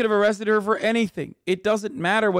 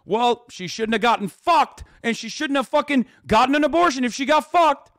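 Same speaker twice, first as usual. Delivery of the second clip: hammed-up outrage of a judgmental chatter; imitating a ranting moralist, rising into a yell; mock shout; 6 semitones higher than usual. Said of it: "say something along the lines of".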